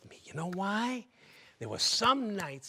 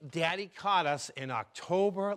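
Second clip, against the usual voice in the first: ordinary talk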